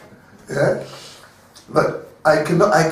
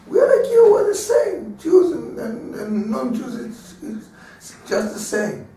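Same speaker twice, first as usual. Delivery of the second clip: eager high pleading voice; 8 semitones higher than usual. Retold or imitated. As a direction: plead to be accepted, then trail off.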